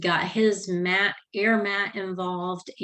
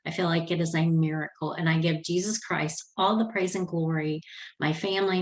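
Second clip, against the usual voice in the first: ordinary talk, nothing marked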